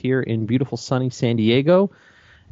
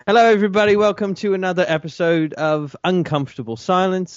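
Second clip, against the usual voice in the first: pumped-up host intro; 8 semitones higher than usual. Shown as a demonstration. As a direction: sell the welcome like a game show host. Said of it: "Take forty-two".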